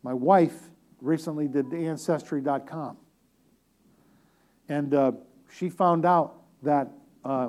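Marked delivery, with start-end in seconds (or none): none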